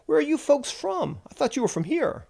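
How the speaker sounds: high-pitched, puzzled out-of-towner; confused out-of-towner. Acted. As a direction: play a baffled visitor, pitched well up. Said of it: "make some confused statement".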